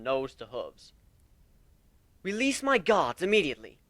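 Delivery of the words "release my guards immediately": loud, high royal command; commanding demand. Acted. Princess demanding her guards' release, loud and commanding. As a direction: order the guards freed with authority. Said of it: "Princess Luna demanded".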